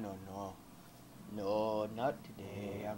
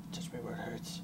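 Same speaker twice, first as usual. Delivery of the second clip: breathy joking whisper, much softer; mock sultry whisper; 5 dB quieter than usual. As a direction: whisper it like a cheesy come-on. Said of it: "I don't need to be talking like that".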